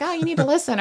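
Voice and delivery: high-pitched mock nagging; hammed-up pestering; mock pushy self-promoter. Mimics pushy self-promoter he is not.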